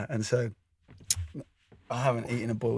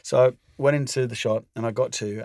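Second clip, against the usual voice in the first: ordinary talk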